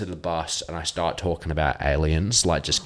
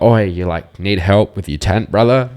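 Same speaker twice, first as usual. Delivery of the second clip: louder friendly call across the road; 2 semitones higher, 10 dB louder than usual. Retold or imitated. Strangers in a car calling to him, loud friendly shout. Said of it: "they're like".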